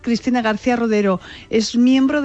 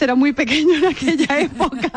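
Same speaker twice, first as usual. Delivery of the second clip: higher, amused, real laugh breaking in; 6 semitones higher than usual. Real, not acted. Reporter jokes colleague was very small back then, laughing. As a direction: let a chuckle overtake the end. Teasing a colleague about his age.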